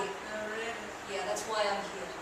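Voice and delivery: low muttering guest. Acted. Mister Green's low gangster voice.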